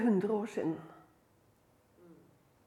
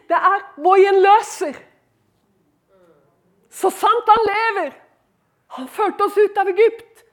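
loud, raised proclamation; fervent preaching; 8 semitones higher, 10 dB louder than usual. Acted. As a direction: declare it boldly to the congregation. Preaches confession at full force.

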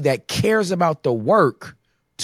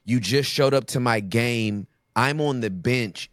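ordinary talk, nothing marked

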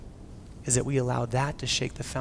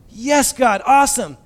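much higher, louder delighted cheer; Abraham cheering God's promise, pitched up and loud; excited yes; 9 semitones higher, 11 dB louder, slower than usual. Retold or imitated. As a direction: cheer like you got the best news.

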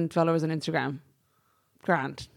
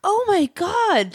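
slow, high, drawn-out disbelief; surprised; 12 semitones higher, much slower than usual. Real, not acted. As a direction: stretch it out in shock. Recalling the morph suit hoodie.

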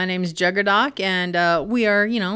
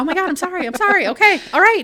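flustered apology; 9 semitones higher, 5 dB louder, faster than usual. Retold or imitated. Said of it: "I'm like".

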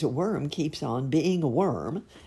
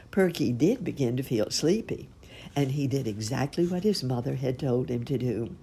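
usual speaking voice

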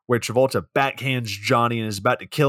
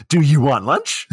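Travolta's weird voice; 3 semitones higher, 5 dB louder than usual. Retold or imitated. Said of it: "It's in that voice, in that damn voice".

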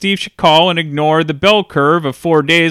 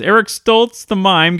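normal talking voice

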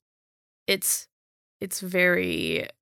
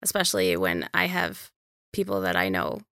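ordinary delivery

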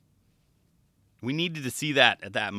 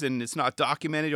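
ordinary talk, nothing marked

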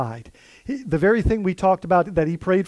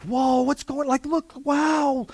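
high, slow gasp of wonder; breathless high awe; amazed crowd; 8 semitones higher, slower than usual. Retold or imitated. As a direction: play the gawking crowd, wide-eyed.

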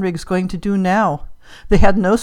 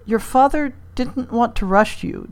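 higher voice, hesitant pauses between phrases; halting; 6 semitones higher than usual. A narrator's reading. Jane tells daughter of father's wishes, halting. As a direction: pick the words with difficulty. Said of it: "Jane said haltingly".

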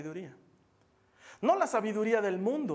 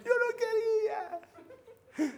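much higher, slow, sobbing plea; whiny drunk teen; 13 semitones higher, much slower than usual. Retold or imitated. Teen begging mom's forgiveness, high crying whine. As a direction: wail your excuses like a drunk kid caught out. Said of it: "Es lo que dicen los adolescentes".

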